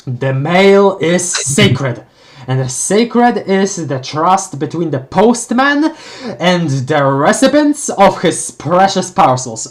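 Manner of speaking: slow, loud, grave proclamation of the postman; hammed-up zealous mail carrier; solemn postman creed. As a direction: declaim it like a sacred oath, slowly.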